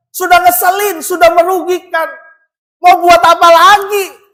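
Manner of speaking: forceful preaching. Acted. Pastor preaching hard to the church about selfish desire, rising fervour. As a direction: drive it home to the congregation.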